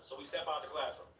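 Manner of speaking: ordinary talk, nothing marked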